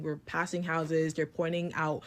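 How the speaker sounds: ordinary delivery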